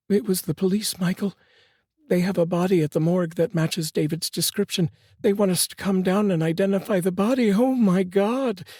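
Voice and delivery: yelling, weeping. A narrator's reading. Mother telling her husband the news, mildly tearful. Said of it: "she yelled as she wept uncontrollably".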